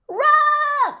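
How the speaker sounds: very high, loud squeal; panicked shout. A narrator's reading. Rabbit fleeing the giant, high panicked shout. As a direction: yell it to get everyone fleeing.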